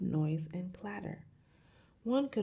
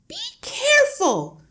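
very high, piercing warning; screeched, stretched cry of alarm; alarmed shriek; 14 dB louder than usual. A narrator's reading. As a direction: shriek to stop him at once. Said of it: "shrieked the mother".

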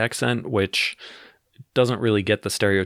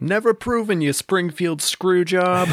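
high, drawn-out Boston heckle; Boston accent yell; 10 semitones higher than usual. Acted. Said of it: "yelling from the other side of the bar in a Boston accent".

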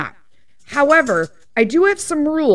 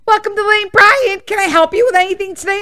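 high, bright, fast salesclerk greeting; chirpy greeter voice; 9 semitones higher, 5 dB louder than usual. Retold or imitated. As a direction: mimic an overeager shop assistant rushing the welcome. Mimics the pushy greeter at the door.

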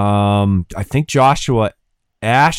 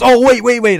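urgent wait; 11 semitones higher, 5 dB louder than usual. Retold or imitated. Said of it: "he goes".

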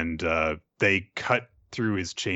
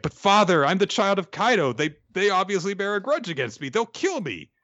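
put-on much higher, louder scared little kid; pleading child; 13 semitones higher, 4 dB louder than usual. Young Yamato begging his father not to leave him with samurai, high child plea. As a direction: beg like a frightened child.

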